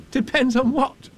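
high, loud question; puzzled boy. A narrator's reading. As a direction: demand an answer as a baffled child. Boy baffled by Pooh.

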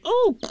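high, loud squeaky ooh; jolted "ooh". A narrator's reading. Piglet bouncing up in the pocket, high squeal. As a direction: squeal as you fly upward. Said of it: "as he went up in the air".

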